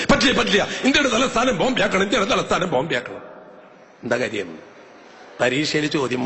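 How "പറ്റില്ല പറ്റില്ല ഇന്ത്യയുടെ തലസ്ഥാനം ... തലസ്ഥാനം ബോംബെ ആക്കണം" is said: put-on stubborn child. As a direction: refuse like a stubborn little girl. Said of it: "ചോദിച്ചു".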